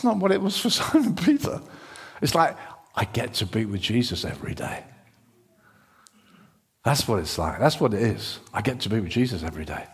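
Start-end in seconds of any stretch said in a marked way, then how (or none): 3.0-4.8 low, soft, intimate quote; hushed wonder. Acted. Voicing Simon Peter's quiet wonder. 8.5-9.9 low, soft, quicker murmur; hushed wonder. Acted. Repeats Simon Peter's quiet wonder.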